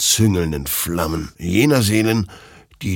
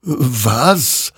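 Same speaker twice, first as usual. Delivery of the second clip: surprised; 6 semitones higher, 3 dB louder than usual. A narrator's reading. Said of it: "wunderte sich dieser".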